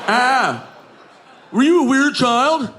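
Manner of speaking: mock shocked heckler; audience member asking. Audience member asks the comic, mock shock.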